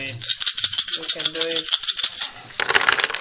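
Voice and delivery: loud, slow chant of encouragement; drawn-out cheer. Real, not acted. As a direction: stretch every word like a stadium cheer. Cheering a friend's dice roll.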